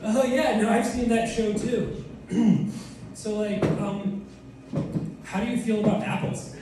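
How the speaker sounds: hesitate, then ease into the question; Johnny Appleseed. Acted. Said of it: "this is Johnny Appleseed on a first date".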